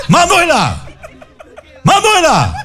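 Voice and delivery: high mock call of a name. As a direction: Marta Julia calls Manuela again, high calling out. Marta Julia calling again.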